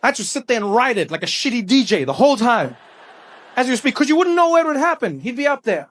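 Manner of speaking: ordinary talk, nothing marked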